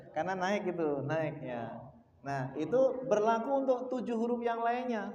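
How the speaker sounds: ordinary talk, nothing marked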